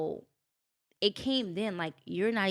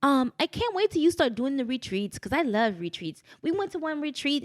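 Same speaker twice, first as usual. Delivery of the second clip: imitated English accent, higher and louder; 7 semitones higher, 5 dB louder than usual. London friend on the phone about retreats, imitated English accent. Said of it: "English accent. She's like".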